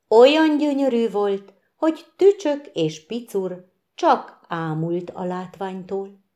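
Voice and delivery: ordinary delivery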